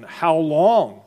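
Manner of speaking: "How long?" lifted as a plea; pleading lament. Acted. Psalmist's lament repeated.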